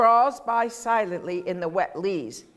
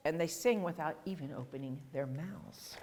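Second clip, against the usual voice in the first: quiet; 4 semitones lower, 14 dB quieter than usual. Shown as a demonstration. Quiet contrast after loud.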